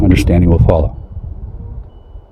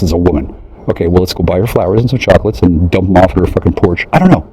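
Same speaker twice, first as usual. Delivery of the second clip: normal talking voice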